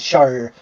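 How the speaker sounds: "shire" said as a regional English ending; southwestern pronunciation. Shown as a demonstration. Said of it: "particularly in the southwest".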